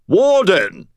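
put-on high, loud British-accented call; obnoxious British call. A narrator's reading. Butters announcing himself as Warden, loud British accent. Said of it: "absolutely obnoxious British accent".

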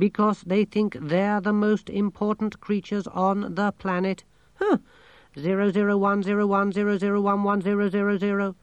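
high, monotone computer voice; talking machine, matter-of-fact. A narrator's reading. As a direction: report it evenly like a machine.

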